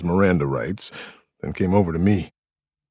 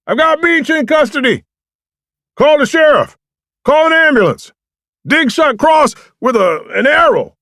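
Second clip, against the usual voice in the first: distant shouting, much higher and louder; yelled back; 11 dB louder than usual. A narrator's reading. French calling back from far off, loud shout. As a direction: shout it a hundred yards through the woods. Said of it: "French yelled back".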